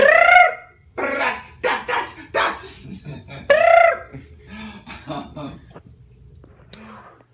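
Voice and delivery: high trilled vocal run; sung laughing trill. Pretend. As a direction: warble a silly high run. Silly vocal warm-up.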